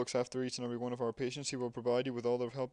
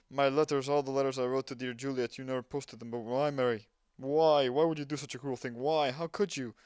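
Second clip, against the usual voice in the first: louder, climbing pleas of "why"; hammed-up wounded sibling demanding answers; loud melodrama, shouting each "Why?"; dramatic anguished reproach; 2 semitones higher, 7 dB louder than usual. Melodramatic letter read aloud.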